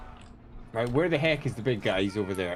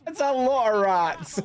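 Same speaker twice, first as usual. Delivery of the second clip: higher and slower, mock amazement; mock awed, drawn out; 8 semitones higher, 4 dB louder, slower than usual. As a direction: stretch it out in mock awe. Repeats own line, drawn out.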